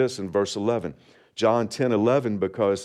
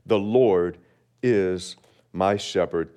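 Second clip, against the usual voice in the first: ordinary talk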